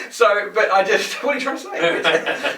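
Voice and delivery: high, loud, laughing indignation; laughing protest. Real, not acted. Friend teasing him, laughing protest. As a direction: laugh it off, mock offended. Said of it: "So you stopped 'cause you wanted".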